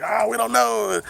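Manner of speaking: high, whiny mock denial; feigned innocence. Teens asked who was smoking it, high mock-innocent denial.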